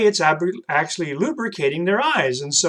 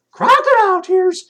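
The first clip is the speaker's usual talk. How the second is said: high, loud puppet asking "Crocodile tears?"; 14 semitones higher, 6 dB louder than usual. Acted. Puppet Troy repeats the new phrase.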